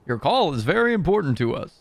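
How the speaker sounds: high, loud, swooping mock announcer; hold-message operator.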